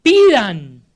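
loud ringing command; a preacher's forceful one-word shout; shouted imperative. Retold or imitated. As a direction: cry it out as an urgent order. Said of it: "son tres gritos del Señor Jesús".

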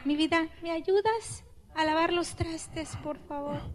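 soft, gentle request; sweet asking. Shown as a demonstration. Wife sweetly asks husband to wash dishes, soft tender plea. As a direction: ask nicely, tender and soft. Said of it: "Pedir, no reclamar".

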